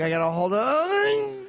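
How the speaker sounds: high, slow, strained plea to himself; straining to hold it. Retold or imitated. Comic telling himself to hold on, high and slow. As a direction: mock-desperate self-talk, drawn out and high. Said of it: "We're like".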